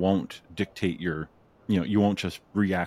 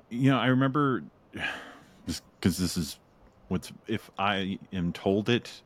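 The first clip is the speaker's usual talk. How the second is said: ordinary delivery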